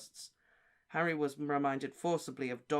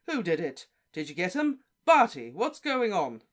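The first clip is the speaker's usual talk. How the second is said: louder, higher, rushed questions; flustered shouting; 4 semitones higher, 9 dB louder than usual. Acted. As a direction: call out in a breathless fluster. Bagman flustered, calling to colleagues.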